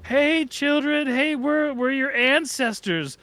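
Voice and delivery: put-on high, slow call to children; coaxing children. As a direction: greet little ghosts gently like a relative. Descendants greeting child ghosts.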